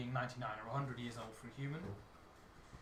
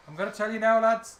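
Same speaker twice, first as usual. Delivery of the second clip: loud, higher Irish brogue; Hollywood Irish; 6 semitones higher, 12 dB louder than usual. Acted. Irish character breaking news to the lads, Hollywood Irish. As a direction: announce it boldly in stage Irish. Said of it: "This is what I call Hollywood Irish".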